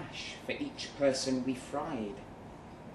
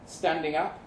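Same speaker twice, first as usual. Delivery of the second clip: usual speaking voice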